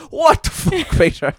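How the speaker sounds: high startled yell; imitating a shocked dad; shocked outburst. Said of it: "And he goes".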